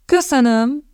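high, coolly formal thanks; stiffly polite reply; measured, reserved. A narrator's reading. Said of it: "felelte Missus Barrell kimérten".

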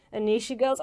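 normal talking voice

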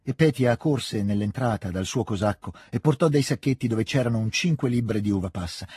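plain reading voice

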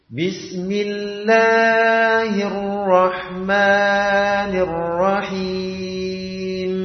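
Bismillahirrahmanirrahim with every vowel stretched far too long; wrong overlong reading. Shown as a demonstration. Teacher demonstrates the wrong reading, vowels overstretched. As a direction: chant Bismillahirrahmanirrahim, over-dragging each long vowel. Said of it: "contohkan bacaan yang salah".

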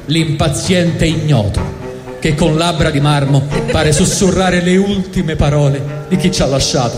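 ordinary delivery

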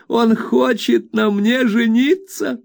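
high, slow scandalised nursemaid; flustered old nurse. A narrator's reading. Peggotty voiced high throughout.

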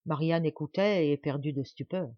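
ordinary delivery

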